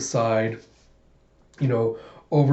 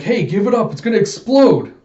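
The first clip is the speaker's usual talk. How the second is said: high, loud, acted alarm; urgent warning shout; 8 semitones higher, 7 dB louder than usual. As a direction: shout to make them give up. Character warns of imminent explosion.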